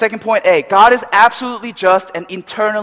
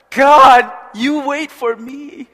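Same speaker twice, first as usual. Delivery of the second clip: higher and slow, choked with emotion; moved to tears; 6 semitones higher, 5 dB louder, much slower than usual. Retold or imitated. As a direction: breathe it out like a tearful prayer. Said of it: "I was like".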